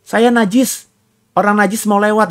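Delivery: high, strained warning cry of a leper; a leper warning passers-by, high and pleading; leper calling out. Retold or imitated. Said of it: "harus teriak itu".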